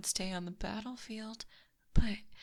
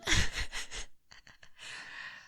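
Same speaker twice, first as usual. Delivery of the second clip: high amused laugh; 8 semitones higher than usual. Acted. Amused by her own threat.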